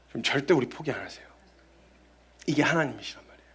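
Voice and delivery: quick, hushed whisper. Real, not acted. Preacher confiding to the congregation, in a quick whisper. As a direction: lean in and whisper it.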